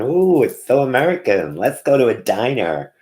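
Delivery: high, loud gushing diner fan; mock gushing. Vlogger mocking diner fans, gushing high and loud. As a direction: ham up the excited diner fan.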